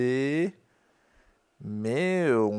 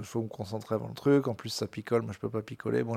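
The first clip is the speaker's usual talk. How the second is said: normal talking voice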